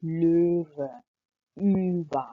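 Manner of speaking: löwe and über said with long umlaut vowels; stretched umlaut vowels. Shown as a demonstration. Teacher models long umlaut words for learners. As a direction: draw out the umlauts slowly. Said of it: "थोड़ा सा लंबा खींच के".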